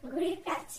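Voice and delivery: ordinary talk, nothing marked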